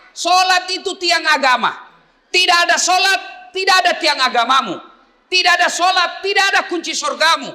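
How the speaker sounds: high, loud fiery sermon; rally speech. Acted. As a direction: build the crowd up, shouting. Preaching at full force.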